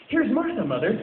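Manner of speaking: put-on high, loud children's cry; excited children. A narrator's reading. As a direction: squeal it out like excited kids. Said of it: "said the two young Cratchits".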